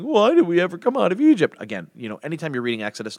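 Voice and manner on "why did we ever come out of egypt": higher, louder mock whine of the complaining people; a hint of a nagging grumbler; whiny Israelites voice. Preacher mimicking the grumbling Israelites in a whiny voice. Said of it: "always use that voice for the people of, of Israel".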